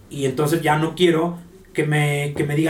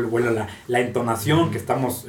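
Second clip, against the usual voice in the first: ordinary talk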